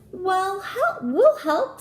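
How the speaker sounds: high, loud eager chirp of an offer; excited little wren calling out; eager little bird. A narrator's reading. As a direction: jump in keenly like a tiny bird.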